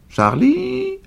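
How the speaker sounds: high ringing call across the woods. A narrator's reading. Old woman calling for the boy, high ringing call. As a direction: call the boy's name far and high. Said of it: "Elle l'appela".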